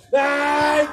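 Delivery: hammed-up shouted curse; mock battle cry. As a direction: yell it like a cartoon villain. Second mock attack on balloon.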